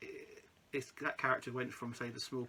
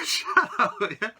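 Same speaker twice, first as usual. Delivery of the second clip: high loud laughing "shut up"; 8 semitones higher, 10 dB louder, slower than usual. Real, not acted. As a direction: squeal it through laughter. Host laughing at guest.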